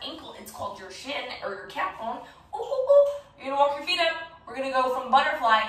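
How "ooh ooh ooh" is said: high, loud delighted oohs; high excited cooing. Real, not acted. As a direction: squeal with delight at them. Excited cheer at kids.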